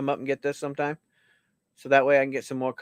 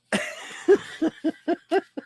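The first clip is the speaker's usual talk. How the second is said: wheezing silent laugh; 12 semitones higher than usual. Real, not acted.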